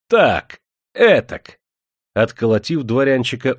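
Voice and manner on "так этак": loud, high, forceful bursts; hitting. A narrator's reading. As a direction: punch out each word with a blow. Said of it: "стал бить его по лицу". (резкие выкрики в такт ударам)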